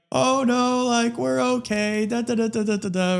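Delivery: high, breezy imitation of the parents brushing it off; lightly mock the folks saying all is fine; parents on the phone. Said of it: "They're like".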